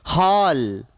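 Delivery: हॉल with a long, open rounded ऑ; open ऑ vowel. Shown as a demonstration. Teacher models the English vowel for students, open ऑ in हॉल. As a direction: say हॉल drawn out, between आ and ओ. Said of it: "हाल नहीं है".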